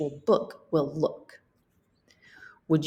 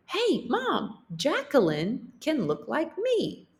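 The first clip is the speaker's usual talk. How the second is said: much higher, eager call to Mom; excited call; 8 semitones higher than usual. Acted. Girl excitedly calling to her mom about her idea. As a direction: call out brightly with a new idea.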